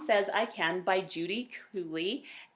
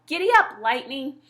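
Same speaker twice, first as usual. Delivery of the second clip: much higher and louder giddy-up shout; cowboy yell; 6 semitones higher, 10 dB louder than usual. Pretend. Boy playing cowboy yelling at his horse, loud. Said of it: "I yell".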